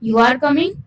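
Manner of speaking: curious, unsure, up on coming; rising question tune. Shown as a demonstration. Teacher showing rising tone on a question. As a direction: voice rises on coming. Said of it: "यहाँ tone ऊपर जा रहा है".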